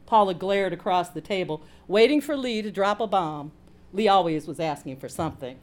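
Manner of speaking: plain reading voice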